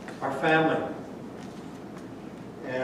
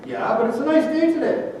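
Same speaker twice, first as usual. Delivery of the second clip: bright insisting; 8 semitones higher than usual. Acted. Upbeat friend insists.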